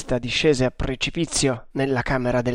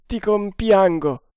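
slightly moved; 6 semitones higher, 5 dB louder than usual. A narrator's reading. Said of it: "con voce lievemente commossa".

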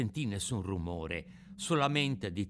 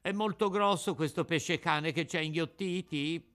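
puppet asking; 6 semitones higher than usual. A narrator's reading. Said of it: "domandò il burattino".

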